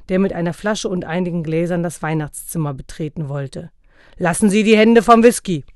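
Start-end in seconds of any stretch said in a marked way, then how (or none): 4.2-5.6 loud, higher-pitched warning; angry shout. A narrator's reading. Father stopping Knecht Ruprecht at the whisky, shouting. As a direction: shout at him to back off.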